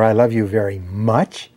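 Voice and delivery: slow, saving the weight for a rising "much"; stress on MUCH. Shown as a demonstration. Speaker showing how emphasis changes meaning, stress on "much". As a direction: hold back, then lift "much" into a question. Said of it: "put the intonation or the emphasis on the words".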